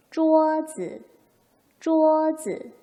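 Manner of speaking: loud 쪼즈, 쪼 held high and level, 즈 short; tone demo. Shown as a demonstration. Teacher models desk like mama, tone demo. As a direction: hold 쪼 high, then let 즈 fall light. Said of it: "이렇게 읽겠죠".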